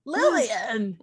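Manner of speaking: loud, drawn-out mock-panicked call. Player calls for the baddie in character, mock panic. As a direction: call the name out in a badly acted panic. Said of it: "in a really bad voice".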